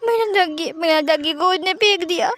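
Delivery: put-on high wailing child's sob; little boy crying over his thrown-away duck. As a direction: wail over the lost toy. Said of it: "wing बहुत रो रहा था".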